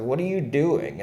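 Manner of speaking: called out high and loud; startled call-out. Retold or imitated. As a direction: shout across to them, puzzled. Calling out to strangers crouched in dirt.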